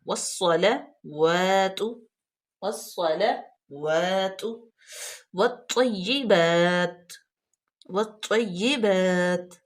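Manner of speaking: very slow "والصلوات" then "والطیبات", each drawn out; careful recitation. Shown as a demonstration. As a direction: model "والطیبات" slowly. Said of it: "واو پہ کھڑی زبر ہے".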